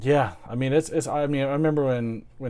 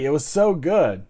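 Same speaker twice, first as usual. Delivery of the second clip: usual speaking voice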